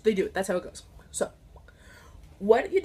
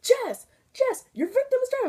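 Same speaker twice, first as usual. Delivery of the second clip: mock instructor yell; 12 semitones higher than usual. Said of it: "my instructor's yelling at me".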